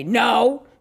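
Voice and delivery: short, firm defiant no; tough defiant girl. Acted. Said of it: "like in a tough girl voice".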